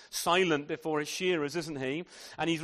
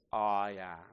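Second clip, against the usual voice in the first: low solemn divine voice; 8 semitones lower than usual. Acted. Jesus takes God's name.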